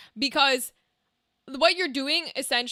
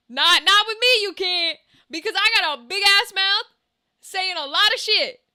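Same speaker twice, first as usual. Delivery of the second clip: loud; 5 semitones higher, 5 dB louder than usual. Real, not acted. Loud boasting retort.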